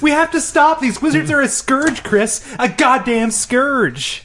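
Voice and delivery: high-pitched hammed-up rant; rally against a menace in comic fury; high, overblown indignation; mock outrage.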